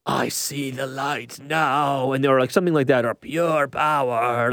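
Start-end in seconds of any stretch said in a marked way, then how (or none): 0.1-2.1 slow, drawn-out, hammed-up dying villain; Star Wars style villain. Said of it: "like Star Wars style". 3.1-4.5 slow, drawn-out, hammed-up dying villain; Star Wars style villain. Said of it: "like Star Wars style".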